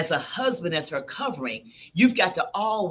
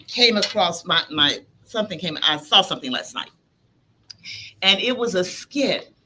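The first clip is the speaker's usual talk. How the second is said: normal talking voice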